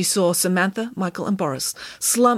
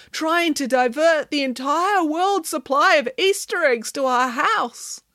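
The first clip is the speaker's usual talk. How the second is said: high, slow lament; despairing; 9 semitones higher, slower than usual. A narrator's reading. Michael on the diverted Easter eggs, slow despairing lament. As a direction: drag it out in despair. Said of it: "despaired Michael".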